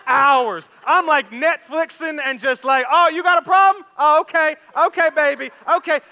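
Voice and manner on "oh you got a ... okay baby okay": high, loud mock-innocent teasing; teasing singsong. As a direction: needle her with sing-song fake sympathy. Dad teasing his panicking daughter.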